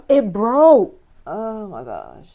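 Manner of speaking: blurting out dismay. Real, not acted. Piece breaks during assembly.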